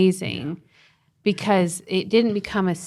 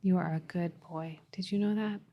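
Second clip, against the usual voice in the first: soft reassurance; 13 dB quieter than usual. Retold or imitated. Mom comforting crying son.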